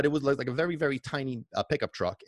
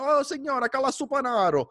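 high friendly call in Italian; vendor calling; 12 semitones higher, 3 dB louder than usual. Retold or imitated. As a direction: vendor calling up to the lady at the window. Vendor calls to lady.

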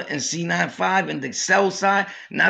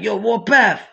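a louder mock hail, pitched up; calling out; 5 semitones higher, 5 dB louder than usual. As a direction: shout a name to get his attention. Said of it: "so he's like".

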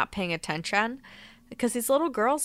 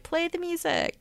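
high, light call to the band; calling for music; 11 semitones higher, 4 dB quieter than usual. Retold or imitated. Actor on stage calling for the music, high and playful. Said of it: "Herbie, like".

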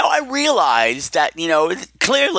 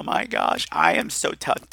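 choked up; 10 semitones lower, 4 dB quieter, slower than usual. Real, not acted. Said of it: "I'm getting a little emotional".

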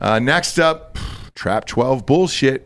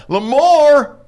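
high, loud hollering at a hunting dog; good ol' boy calling his dog in; 13 semitones higher, 5 dB louder than usual. Acted. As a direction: holler the dog's name across a field. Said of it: "It sounds like a, somebody's old fucking hunting dog".

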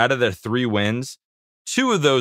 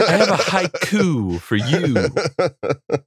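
laughing; 6 semitones higher, much slower than usual. Pretend. Joking poetry offer.

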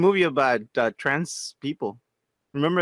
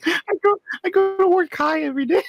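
laughing through the confession; 13 semitones higher than usual. Real, not acted. Friend confessing he works high, laughing. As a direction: admit it while cracking up.

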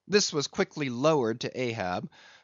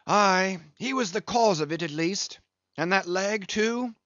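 a lightly voiced English captain, a touch higher; a rueful old English whaler telling his loss; a hint of a weathered English captain; Englishman captain; 7 semitones higher than usual.

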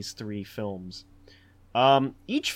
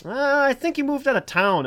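higher, louder mock-casual quote; cafe friends covering; 11 semitones higher, 7 dB louder than usual. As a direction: act out pals playing dumb, pitched up. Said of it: "They're like".

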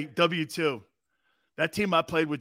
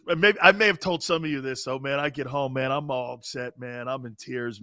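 ordinary delivery

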